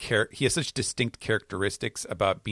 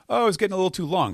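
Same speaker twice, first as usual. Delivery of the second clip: quick, higher-pitched, offhand explanation; lightly voiced excuse; 11 semitones higher, 5 dB louder, much faster than usual. Scientist explains his missing giant head, lightly voiced excuse. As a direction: toss off the excuse fast and high.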